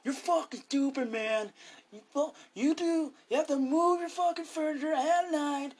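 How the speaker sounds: hammed-up furious rant. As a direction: rip into them like a movie star losing it.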